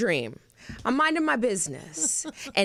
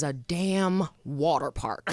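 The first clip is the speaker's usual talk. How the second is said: deadpan; 7 semitones lower, 3 dB quieter, slower than usual. Retold or imitated. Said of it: "Danielle says".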